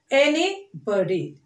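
ANYBODY slowly as any, body; two separate halves in ANYBODY; split in two. Shown as a demonstration. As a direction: pause in the middle of ANYBODY. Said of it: "splitting the word like this".

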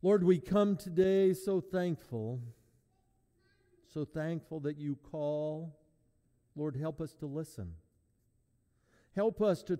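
earnest, prayerful voice. Real, not acted. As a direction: pray it sincerely. Opening prayer.

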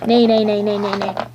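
playful horse neigh. Pretend. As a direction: neigh like a pony. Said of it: "my talent is to neigh".

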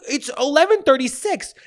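high-pitched mock outrage; indignant retort. Latecomer disputing the time with passengers, indignant. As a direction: protest it with affronted pitch. Acted retort about being one minute late.

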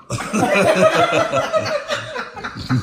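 very high, long squeal of laughter. Real, not acted. Prankster cracking up after the reveal, squealing laugh.

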